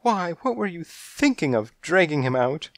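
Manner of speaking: high-pitched motherly scolding; high fretful mother scolding. Acted. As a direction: tell him off in a high fussing voice. Mother scolds over cold baby.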